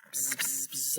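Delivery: hushed bee buzz; soft buzzing. Acted. Showing kids the bee's buzz, soft buzzing.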